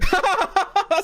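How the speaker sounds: high cackling laugh; helpless high-pitched laughing; burst of laughter. Real, not acted. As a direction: crack up at the clip. Reacting to the played clip.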